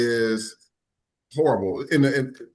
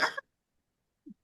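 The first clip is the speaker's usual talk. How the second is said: short high imitated scream, quiet and breathy; child's scream; 10 dB quieter than usual. Retelling his sister screaming from inside the house. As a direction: mimic a little girl's shriek. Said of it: "She was screaming".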